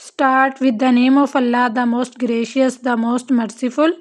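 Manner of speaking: slow recitation. Real, not acted. Opening Bismillah recitation.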